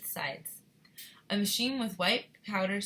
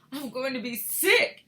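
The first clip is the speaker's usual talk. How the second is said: higher, louder cry of nausea; queasy yell; 7 semitones higher, 8 dB louder, slower than usual. A narrator's reading. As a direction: yell a warning that you'll be sick. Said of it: "yelled Missus Teavee".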